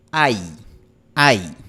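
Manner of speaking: ai con final alto y frontal en i; diptongo español ai. Shown as a demonstration. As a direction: cerrar bien hacia la i. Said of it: "En español terminamos con la lengua". (ai español, terminando en i cerrada)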